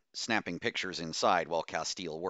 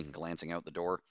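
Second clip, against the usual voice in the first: plain reading voice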